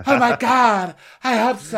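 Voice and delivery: high, loud mock gasp of hope; over-the-top squeal; hammed-up outcry. Acting out cast's hammed reaction.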